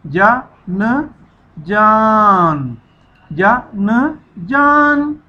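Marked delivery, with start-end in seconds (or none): 1.6-2.8 जान drawn out very slowly as one word; slow blend. Shown as a demonstration. Teacher modelling the joined word for pupils, slowly. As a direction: lean on the long आ of जान before the न. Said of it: "इकट्ठा पढ़ना है".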